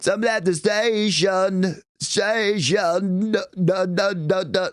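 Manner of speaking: sung as if with a head cold; mildly nasal cold sound; stuffy nose. Shown as a demonstration. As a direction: sing like your nose is stuffed up. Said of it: "sounds like he's got a cold".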